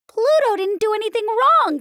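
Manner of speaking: put-on a small boy protesting; young nephew. A narrator's reading. Ferdy defends Pluto to Minnie, high indignant protest. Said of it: "Ferdy said".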